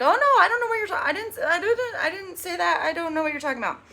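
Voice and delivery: high, fast, flustered denial; high-pitched mock-innocent babble; playing dumb. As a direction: act innocent and scramble over the words. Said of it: "this way of playing dumb when confronted".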